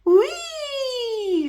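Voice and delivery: high, far-off squeal of delight; gleeful whee. A narrator's reading. Bridget squealing on the skateboard, high and gleeful. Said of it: "she yelled".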